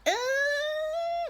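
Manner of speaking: high wailing "Ah" as the box spins; dizzy cry. Acted. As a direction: cry out while spinning. Everyone spinning, trying not to be sick.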